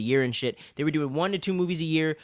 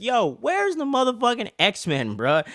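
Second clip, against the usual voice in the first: mock She-Hulk demand; 8 semitones higher, slower than usual. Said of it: "and she's like".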